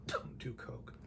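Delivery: breathy whisper, almost silent; whispered aside. Acted. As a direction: slip in a hushed warning to the audience. Whispered aside after Ellis dies.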